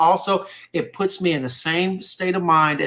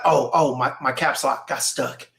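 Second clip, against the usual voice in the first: low, slow imitation of dad; retold dad's excuse; 6 semitones lower than usual. Dad excusing his all-caps text to son, low slow mock excuse. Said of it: "He'd be like".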